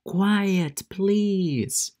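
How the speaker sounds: high, sing-out classroom call; high-pitched, drawn-out request to pupils; teacher hushing class. Retold or imitated. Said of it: "yo les digo".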